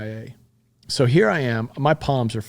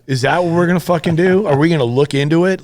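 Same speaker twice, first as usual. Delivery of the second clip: higher and louder, angry and indignant; furious outburst; 6 semitones higher, 6 dB louder than usual. Real, not acted. Retelling his meeting with the director, furious outburst. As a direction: let the anger burst out at the words. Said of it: "I was so mad".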